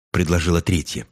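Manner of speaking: plain reading voice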